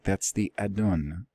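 usual speaking voice

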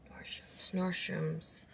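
breathy whispered aside; barely voiced, under her breath. Real, not acted. Muttering store name to herself.

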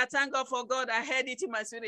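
ordinary delivery

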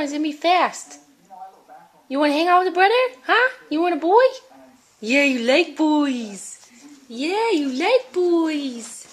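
high, loud sing-song pet voice. Real, not acted. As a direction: coo at the dog, drawing words out. Cooing at her dog.

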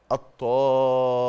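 الطامة with a long stretched aa, slow; elongated madd. Shown as a demonstration. Teacher models heavy obligatory madd. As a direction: hold the madd before the doubled م. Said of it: "الميم دي كان أصلها اتنين ميم،".

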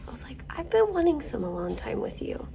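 soft hushed whisper. Real, not acted. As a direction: whisper it close and quiet. Whispering in the waiting room.

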